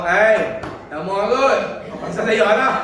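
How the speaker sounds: a loud, teasing angel's retort; mock angel exclaiming. Preacher voices the angel scolding Idris, loudly. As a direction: bark it out half in jest as the angel. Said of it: "Malaikat kata".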